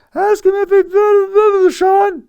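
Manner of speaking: imitated heckler hollering at the ring; fan yelling from the crowd. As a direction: bellow it across an arena. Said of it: "one fan just yell out".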